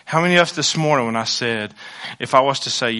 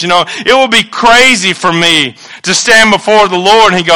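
ordinary talk

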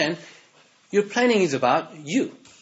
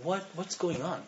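ordinary delivery

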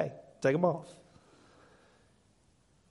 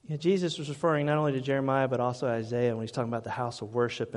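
ordinary talk

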